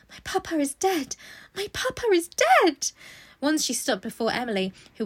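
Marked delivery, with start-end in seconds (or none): 0.1-2.9 rising into a higher, slower anguished cry; voice not her own. A narrator's reading. Orphaned girl pacing her room: building anguished cry. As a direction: repeat it, growing more desperate. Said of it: "in a voice which did not seem her own".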